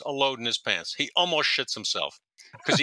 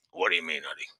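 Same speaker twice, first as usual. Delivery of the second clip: lightly imitated captain, lower and softer; George Duke; 7 semitones lower, 4 dB quieter, faster than usual. Captain puzzled by Eddie's question, lightly imitated. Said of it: "a very distinct way of talking".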